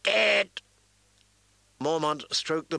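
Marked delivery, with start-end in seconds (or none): 0.0-0.6 high, louder squawking bird cry; louder shrill raven caw. Acted. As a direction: shriek it like an agitated raven. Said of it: "Its voice grew louder and more shrill".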